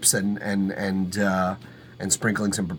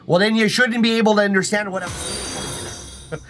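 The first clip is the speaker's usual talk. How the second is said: put-on high, teasing Canadian accent; 8 semitones higher than usual. Streamer teases a non-Canadian viewer in a mock Canadian accent. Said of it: "interrupting me in my accent".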